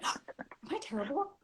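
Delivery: hushed anxious whisper to yourself; panicked whisper. Retold or imitated. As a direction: breathe it out like a secret fear. Panicked self-doubt while driving.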